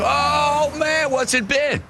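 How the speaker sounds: very high, drawn-out imitated cry; exclaiming. Toll collector trying to place a returning driver, high exclaiming. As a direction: stretch it out high, racking your memory.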